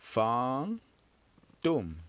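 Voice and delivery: fantôme said slowly, the nasal fan then a long falling tome; teacher modelling fantôme; French pronunciation. Shown as a demonstration. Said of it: "Very good".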